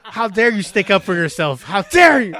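high, loud hammed-up indignation; mock outrage. Dad answering kids who stand up, mock outrage. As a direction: explode in playful outrage, laugh in it. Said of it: "I go into the character".

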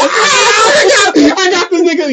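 very high, loud crying shout; squealing mock cry. Soldier hamming up a loud, high wailing yell. Acting out reaction to joke.

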